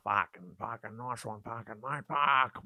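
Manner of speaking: put-on caricatured macho Australian accent; mock blokey Aussie babble; masculine Aussie. Comic imitating very masculine Colin, caricatured Australian accent. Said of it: "very, like, masculine".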